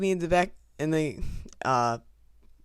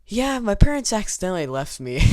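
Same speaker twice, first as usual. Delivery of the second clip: higher, louder, half-laughing blurt of being left behind; blurted admission; 6 semitones higher, 4 dB louder than usual. Retold or imitated. Lost kid blurting to a helpful stranger, higher and louder. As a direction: blurt it out, sheepish and keyed up.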